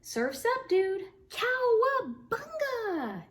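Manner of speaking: high, loud surfer-dude whoop. Acted. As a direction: yell it riding a big wave. Otter acts out surfing.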